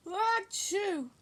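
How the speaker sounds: high loud sneeze; sneezing. Acted. Acted cold, sneeze.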